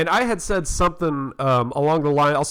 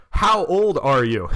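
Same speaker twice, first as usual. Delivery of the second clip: slow clear English; 4 dB louder, slower than usual. Shown as a demonstration. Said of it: "what he was trying to say was".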